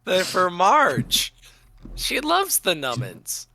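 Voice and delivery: put-on slow, high cutesy baby voice; baby talk. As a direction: coo it like to a pet. Cutesy talk about pet treats.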